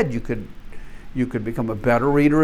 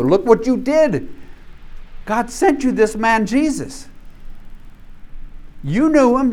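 loud, higher, pointed accusation; accusing preacher; 5 semitones higher, 4 dB louder than usual. Acted. Peter accusing the crowd of killing Jesus, loud. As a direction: jab each "you" at them.

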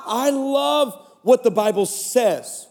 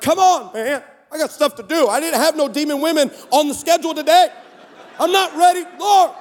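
exasperated; 6 semitones higher than usual. Retold or imitated. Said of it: "I'm like".

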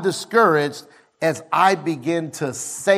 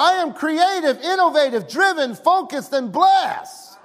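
raised, higher shouted affirmations; preaching declaration; 10 semitones higher than usual. Acted. Pastor leads the church in affirmations, preaching at full force. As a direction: proclaim each word over the crowd.

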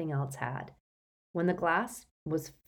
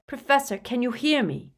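raised voice, high and insistent; loud, urgent; 6 semitones higher, 5 dB louder than usual. A narrator's reading. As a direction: call out to rouse him. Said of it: "he repeated loudly".

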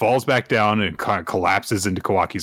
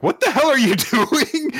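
shocked Sarada. Retold or imitated. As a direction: yell at him to stop, half laughing. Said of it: "Sarada grabs him, is like".

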